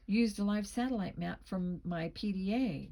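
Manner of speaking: plain reading voice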